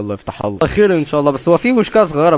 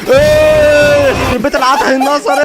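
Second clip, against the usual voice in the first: angry outburst; 12 semitones higher, 7 dB louder, much slower than usual. Real, not acted. Gamer curses sudden kill.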